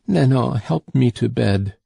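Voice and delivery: ordinary delivery, nothing marked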